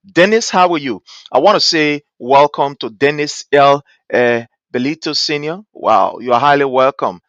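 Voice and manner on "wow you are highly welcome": ordinary talk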